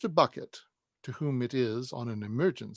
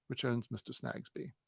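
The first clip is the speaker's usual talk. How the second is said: ordinary delivery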